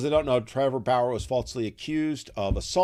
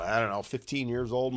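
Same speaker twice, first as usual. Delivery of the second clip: ordinary talk, nothing marked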